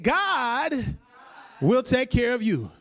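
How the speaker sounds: put-on emphatic declaration, like an old Pentecostal pastor; say it like you mean it. Shown as a demonstration. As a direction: preach it with full conviction. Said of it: "say it like you mean it".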